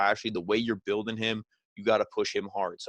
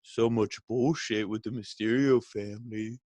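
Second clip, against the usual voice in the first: Rollins, mock apology. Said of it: "And then Rollins is like".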